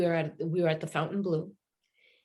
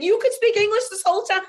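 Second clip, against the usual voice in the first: much higher and louder, incredulous question; astonished; 10 dB louder than usual. Retold or imitated. Laura to Giacomo on learning he spoke English all along, incredulous. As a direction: disbelief bursting out. She realizes he speaks English.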